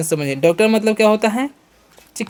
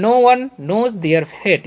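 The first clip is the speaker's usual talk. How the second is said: very slow, word by word; slow classroom dictation; slow example sentence; 3 semitones higher, much slower than usual. Shown as a demonstration. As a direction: spell it out slowly for the class. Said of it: "sentence बनाना है".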